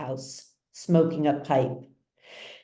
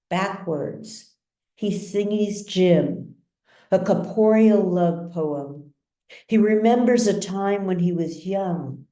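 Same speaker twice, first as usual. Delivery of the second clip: plain reading voice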